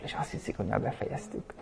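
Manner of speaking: whispered, barely audible. Real, not acted. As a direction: drop to a secret whisper so they think it's over. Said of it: "Majd halkan mondom mostantól".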